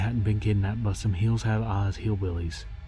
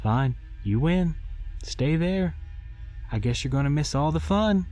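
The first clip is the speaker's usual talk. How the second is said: teasing sing-song call; 8 semitones higher than usual. A narrator's reading. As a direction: lure her out with fake disappointment. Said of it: "I called into the darkness".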